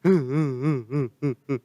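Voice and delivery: daughter's mock sobs; short repeated fake sobs; imitated crying. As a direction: sob in little pulses like a weeping girl. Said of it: "cuma bisa nangis kayak mamanya".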